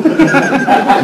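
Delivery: high delighted laugh; delighted laugh at the memory. Real, not acted. Speaker laughs recalling the scene.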